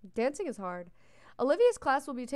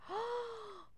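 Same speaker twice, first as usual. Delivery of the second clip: delighted gasp; 11 semitones higher, 8 dB quieter than usual. Real, not acted. Gasp at cute character.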